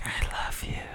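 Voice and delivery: soft unvoiced whisper. Shown as a demonstration. Repeating his whispered love confession to her, in a whisper. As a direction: breathe it to her, barely there. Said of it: "just like that".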